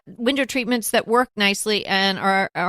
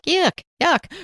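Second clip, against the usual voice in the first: grossed out yuck; disgusted; 10 semitones higher than usual. Retold or imitated. Quoted disgust at the word.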